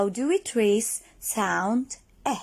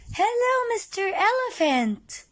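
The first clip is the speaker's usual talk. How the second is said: excited greeting; 10 semitones higher, 3 dB louder than usual. Acted. Teacher greets elephant excitedly.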